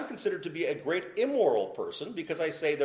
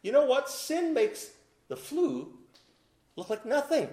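rising, emphatic pulpit voice; preacher driving the point home; preaching; 11 semitones higher than usual. Acted. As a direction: build it up from the pulpit.

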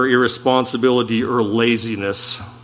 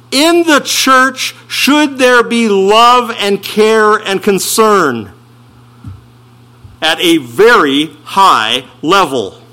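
slow, higher rising appeal to the flock; preaching; 5 semitones higher, 4 dB louder, slower than usual. Acted. Preacher asks the congregation, slow and raised. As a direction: lift it up to the congregation slowly.

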